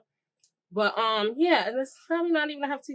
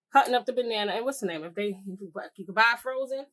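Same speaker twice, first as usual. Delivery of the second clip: ordinary talk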